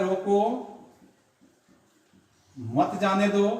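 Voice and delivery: रोको, a long break, then मत जाने दो; pause after रोको. Shown as a demonstration. Teacher dictating the example to stop him, pause after रोको. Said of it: "अल्प विराम का प्रयोग किया गया है".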